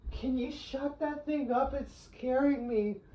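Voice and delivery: high, whiny complaining friend voice; annoyed friend. Retold or imitated. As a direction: whine it like a spooked friend. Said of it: "on a FaceTime call and hear".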